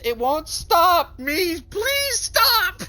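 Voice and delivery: high, slow crying scream; screaming plea. Acted. Yumi screams at the player to stop the power, crying. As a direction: wail it out, begging. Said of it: "Yumi screams".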